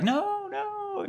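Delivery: put-on very high, whiny child's no; toddler whine. Daughter calling the parent back, in a very high whiny toddler voice. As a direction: squeal it like a toddler. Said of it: "she'll be like".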